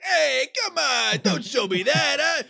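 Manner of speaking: high, slow squeaky Penguin voice; imitating Penguin. Quoting Penguin to friends in a high whiny voice. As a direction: whine it out high and slow like a greasy villain. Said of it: "And he's like".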